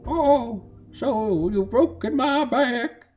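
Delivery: put-on high, slow old-man voice. Shown as a demonstration. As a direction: drag it out as a high-pitched old codger. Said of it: "favorite voice to do is an old man".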